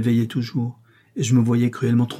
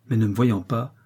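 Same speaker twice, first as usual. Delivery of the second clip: usual speaking voice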